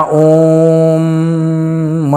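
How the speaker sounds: "ओम" held very long with the weight on the humming "म"; stress on म. Shown as a demonstration. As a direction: let the "म" of "ओम" ring long. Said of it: "म पे ज़्यादा जोर दे रहे हैं". ("ओम" में "म" पर लंबा ज़ोर)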